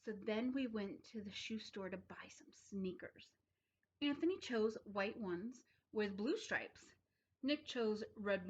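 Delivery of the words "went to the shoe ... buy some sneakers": whisper. A narrator's reading. Story reading, sudden hush.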